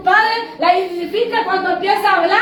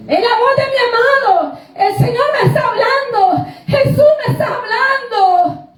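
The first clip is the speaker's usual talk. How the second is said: higher-pitched, exultant proclamation; joyful exclamation; 5 semitones higher than usual. Acted. Preacher voicing the bride hearing her beloved, joyful proclaiming. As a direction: proclaim it with rising joy. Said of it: "eso me llena de gozo, de alegría".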